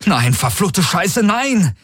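high, drawn-out anguished shout; frantic protest climbing very high; desperate cursing cry. A narrator's reading. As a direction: curse and cry out in despair. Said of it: "rief er".

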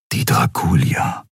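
breathy inner-voice murmur; hushed, like a thought in the head; thought aloud. A narrator's reading. Keru thinks of the flagship, in a hushed inner voice. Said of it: "dachte Keru".

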